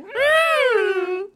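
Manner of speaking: high, drawn-out "mm" whine; mock muffled whimper; pouting child's whine. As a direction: imitate a sulky kid whining into a shoulder. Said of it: "burrow her face in your body and be like".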